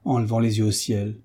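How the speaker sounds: plain reading voice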